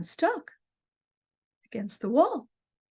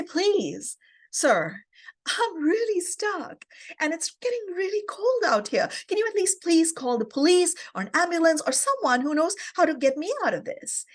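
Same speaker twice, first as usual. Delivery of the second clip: higher-pitched, acted-out plea for help; pleading; 6 semitones higher than usual. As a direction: beg a reluctant stranger, pitch raised. Begging stranger at door for help.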